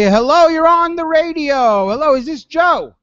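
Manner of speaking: high, loud radio host hello; on-air greeting. Real, not acted. Radio host taking a phone call, high and projected greeting. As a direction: draw it out high to welcome a caller on air.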